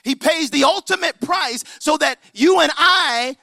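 high, loud, ringing proclamation; impassioned preacher's climax; full-force preaching. Real, not acted. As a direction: lift it to the back row.

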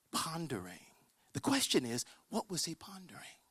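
very soft, low, reverent near-whisper; secret-telling hush, pitched low; hushed storytelling. Retold or imitated. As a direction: let it out quietly with long pauses. Hushed retelling of the kitchen moment.

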